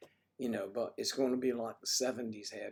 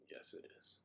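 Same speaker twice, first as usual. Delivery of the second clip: whisper; 12 dB quieter than usual. Real, not acted. Unvoiced answer.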